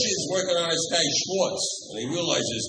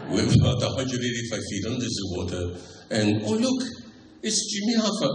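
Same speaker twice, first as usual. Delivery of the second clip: mock French undersea documentary host; Jacques Cousteau. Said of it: "the undersea world of Jacques Cousteau".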